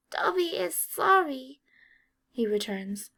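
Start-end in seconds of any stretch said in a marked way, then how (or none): 0.1-1.6 high, slow, shaky elf voice; trembling elf apology; quavering house-elf. A narrator's reading. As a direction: squeak it out, rattled and sorry. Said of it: "voice quavering from the recent violence".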